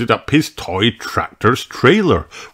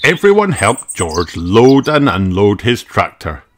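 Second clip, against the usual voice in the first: ordinary delivery, nothing marked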